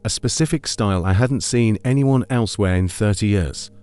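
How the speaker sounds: ordinary delivery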